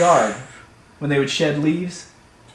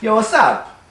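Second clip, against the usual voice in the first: higher, louder cocky-cat hello; new cat's greeting; 8 semitones higher, 5 dB louder than usual. Acted. New cat greeting the neighborhood cats, high breezy call. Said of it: "new cat would come along and go".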